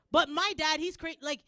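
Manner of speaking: normal talking voice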